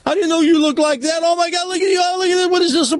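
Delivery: very high, wailing happy sobs, hammed-up; crying with joy. Blind man seeing for the first time, hammed-up joyful crying. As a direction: cry out in overwhelmed, squealing disbelief. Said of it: "He starts crying".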